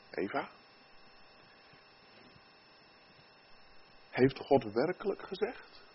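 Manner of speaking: soft, voiced near-whisper with long pauses; whispering tempter. Satan planting doubt in Eva, soft whispering tempter. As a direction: plant the doubt softly, pausing between phrases. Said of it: "Satan komt en die fluistert".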